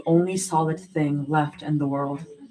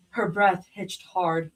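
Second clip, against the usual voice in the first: plain reading voice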